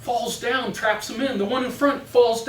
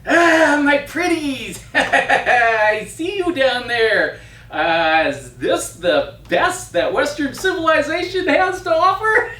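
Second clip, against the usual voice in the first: high, cackling hag voice; cackling witch voice; 6 semitones higher, 5 dB louder, slower than usual. Acted. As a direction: play the green hag, gloating and cackling. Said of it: "and she comes in and says".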